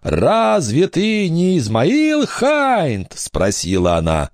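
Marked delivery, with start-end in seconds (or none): 0.0-3.1 slow, high ghostly question; voice from the grave. A narrator's reading. Girl posing as ghost questions pirate, high sepulchral voice. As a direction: intone it slowly as a phantom. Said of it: "загробным голосом заговорила".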